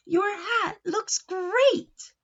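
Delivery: high, very slow and drawn-out; gushing praise. Acted. A friend praises Uncle Nacho's hat, high and gushing. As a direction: gush over the hat. Speech bubble voiced.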